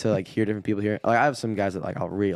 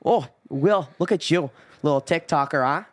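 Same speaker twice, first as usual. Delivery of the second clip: higher, louder teasing uncle voice with Midwest twang; Midwestern uncle; 7 semitones higher, 5 dB louder than usual. Retold or imitated. Imitating his uncles teasing him, Midwestern accent. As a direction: rib him like a Wisconsin uncle. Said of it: "uncles talk".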